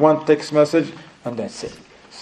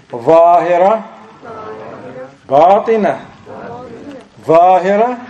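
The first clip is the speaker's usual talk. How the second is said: loud, higher-pitched model words; call-and-repeat drill; 4 semitones higher, 4 dB louder, slower than usual. Shown as a demonstration. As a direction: call each word out for a class to echo. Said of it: "they said repeat only two things".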